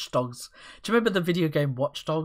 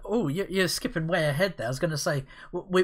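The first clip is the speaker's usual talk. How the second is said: usual speaking voice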